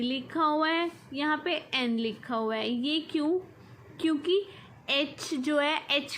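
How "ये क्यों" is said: normal talking voice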